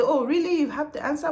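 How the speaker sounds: high, loud, surprised caller; mock surprise. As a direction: act amazed he has the answer. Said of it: "wait for him to say".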